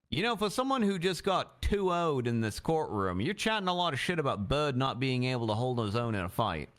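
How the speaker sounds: ordinary talk, nothing marked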